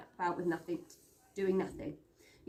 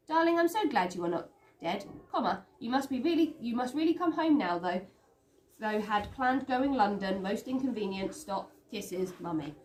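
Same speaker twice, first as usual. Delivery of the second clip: telegram in Mummy's voice; 3 semitones higher, 4 dB louder than usual. Acted. Daisy reads her mother's fussy telegram aloud, gushing.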